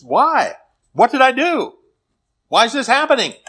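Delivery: high-pitched, bewildered outcry as Job; acted, exasperated pleading; protesting plea. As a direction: fire off anguished questions, pitch jumping up. Job questioning his suffering.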